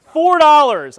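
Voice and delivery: high, very loud, falling call; loud announcement. Real, not acted. Presenter revealing the card's cost to the audience, announcing loudly. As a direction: boom out the answer to the room.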